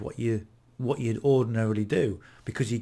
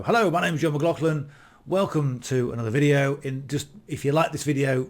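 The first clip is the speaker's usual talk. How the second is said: higher, projected video-presenter intro; 3 semitones higher than usual. Shown as a demonstration. Vlogger greeting viewers the old way, in a put-on presenter voice. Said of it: "we come over as, as presenters".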